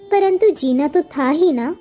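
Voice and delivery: plain reading voice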